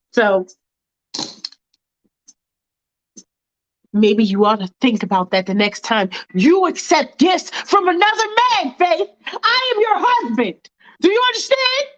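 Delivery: husband warning his wife, rising threat; threatening husband. Acted. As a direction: lecture her, then press hard.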